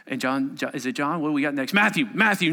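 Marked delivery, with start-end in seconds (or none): none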